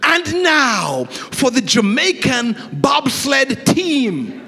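booming slow sports announcer call. Retold or imitated. Preacher mimicking the TV announcer, slow and booming. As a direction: announce it like a TV broadcaster. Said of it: "the announcer came on and he said".

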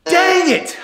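high loud exasperated cry; frustrated shout. Real, not acted. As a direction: yell it out, fed up. Remedy failed again.

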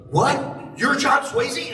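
high, loud mock amazement; astonished fans. Retold crowd recognizing the actor.

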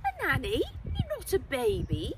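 chatty schoolboy on the phone; young Wen. Acted. Wen answering Henry's late call, eager chatty boy.

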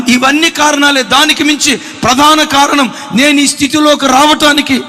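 fervent pulpit proclamation; preaching at full force. Acted. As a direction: build the testimony up and hammer it home. Preaching builds to climax.

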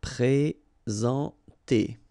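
"Présenter" said very slowly, pre-zahn-TAY, with a nasal en; slow French model word. Shown as a demonstration. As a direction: drag out "Présenter" so every sound is clear. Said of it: "combine the two, e, n, nasal, en".

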